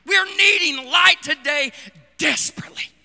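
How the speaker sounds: usual speaking voice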